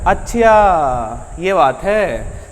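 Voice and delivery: very slow, long-stretched vowels; drawn-out realization. Acted. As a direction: drag it out like it finally makes sense. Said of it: "जैसे खींच के बोलता है". (खींच-खींचकर, जैसे बात अब समझ आई)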